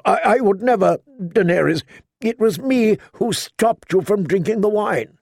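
lightly voiced knight, hurt and insistent; a hint of a wounded knight pleading his case; Jorah, pleading.

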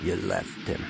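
low, hushed accusation; quiet, calm. A narrator's reading. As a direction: say it softly and low, dead calm. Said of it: "quietly, calmly".

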